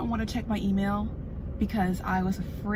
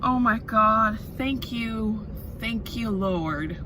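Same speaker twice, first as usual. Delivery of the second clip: grateful outburst; 6 dB louder, much slower than usual. Real, not acted. Grateful outburst at job offer.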